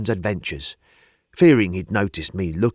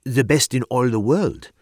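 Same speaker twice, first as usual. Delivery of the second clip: put-on French accent; 3 semitones higher than usual. A narrator's reading. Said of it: "her accent she was from the continent".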